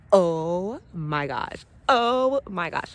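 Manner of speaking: long, drawn-out amazement; real excited awe. Real, not acted. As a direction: stretch each exclamation slowly in wonder. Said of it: "I get excited about nature".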